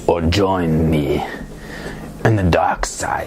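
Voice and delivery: slow, menacing dark side villain. Pretend. Inviting others to join him, in a slow dark side voice. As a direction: draw it out darkly, sinking into a whisper. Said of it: "with my dark side voice".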